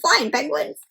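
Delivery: ordinary delivery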